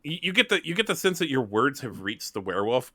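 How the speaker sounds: ordinary talk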